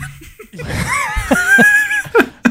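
shrieking burst of laughter; loud laugh. Real, not acted. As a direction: howl with laughter. Cousin joke lands.

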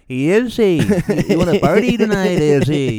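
put-on loud, high Spanish-accented party invite; mock Spanish accent. Showing off his Spanish impression to his co-host, loud hammed-up accent. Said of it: "she sounds kinda Spanish".

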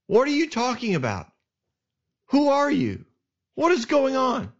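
high, yelling confused questions; mock yell of a startled commander. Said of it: "he might have even yelled that".

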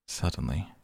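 ordinary delivery, nothing marked

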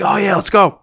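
high, hyped-up victory cry; retold cheering. Winners at the table hype each other up, cheering. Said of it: "My buddy and I are cheering".

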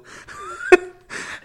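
a very high laugh that cracks toward a cry; high squealing laugh. Real, not acted. Recalling getting fired, breaking into a high laugh. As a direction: burst into a squeaky, helpless laugh. Laughing at his own firing.

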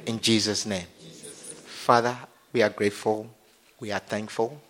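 low, soft prayer voice; leading prayer. Acted. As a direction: lead them quietly, phrase by phrase. Leads congregation in prayer.

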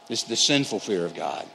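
ordinary talk

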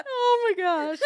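laughing high and loud. Real, not acted. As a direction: laugh helplessly. Laughing after joke.

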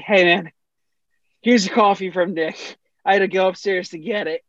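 lightly panting intern; out of breath. Retold or imitated. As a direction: say it short of breath. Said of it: "out of breath 'cause he".

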